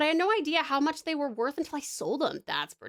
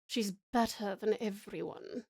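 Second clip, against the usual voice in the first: character voice; 5 semitones lower, 6 dB quieter than usual. Retold or imitated. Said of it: "she is the one who talks like this".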